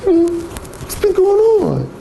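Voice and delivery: high, fake-friendly chitchat; mock the phony caller; high sing-song mock small talk. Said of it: "that phony, disingenuous".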